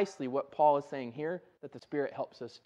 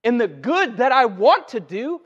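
forceful, raised voice quoting scripture; impassioned scripture quote; 2 semitones higher, 6 dB louder than usual. Retold or imitated. Preacher quoting Paul's struggle to the congregation, impassioned. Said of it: "Paul says it this way".

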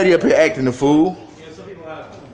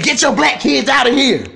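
higher, drawn-out mock yell; imitated yelling; 7 semitones higher, slower than usual. Man telling bystanders what she said, imitated yell. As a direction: slowly mimic her angry order, pitched up. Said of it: "she came up just yelling".

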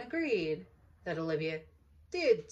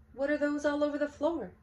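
higher, louder mother voice; exasperated mother; 9 semitones higher, 7 dB louder than usual. Acted. Mother scolding Olivia about socks, raised voice. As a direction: mom eyeing the mess.

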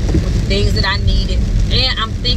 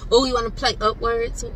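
ordinary talk

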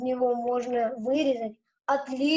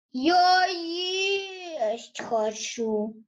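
slow, drawn-out whine; whining hungry; much slower than usual. Acted. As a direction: whimper like a hungry child. (протяжное нытьё)

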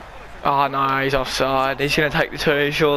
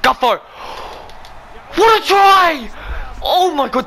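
much higher, louder, drawn-out try call; ecstatic cheer; 10 semitones higher, 3 dB louder, much slower than usual. Real, not acted. Gamer celebrating his team's try, ecstatic shout. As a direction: scream it out like a fan in the stands.

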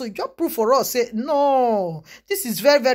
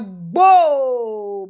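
sharp mock slap noise "Gbo"; a loud slap noise made with the mouth; slap sound; 5 dB louder than usual. Telling the slap on the policeman, a loud mock slap noise.

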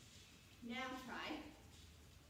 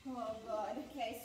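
ordinary delivery

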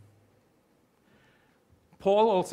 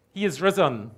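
louder joyful proclamation; proclaiming; 3 semitones higher, 6 dB louder than usual. Real, not acted. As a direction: announce it like good news. Proclaiming resurrection to congregation.

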